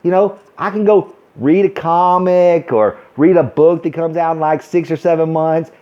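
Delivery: usual speaking voice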